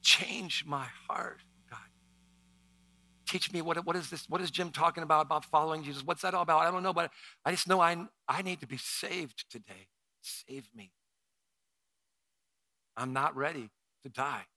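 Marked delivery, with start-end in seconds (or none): 0.0-9.9 earnest questioning prayer; leading a prayer. Acted. Continued guided prayer. 10.2-10.9 much softer, near-breath plea; hushed prayer. Acted. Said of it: "Just say, "Lord, I surrender".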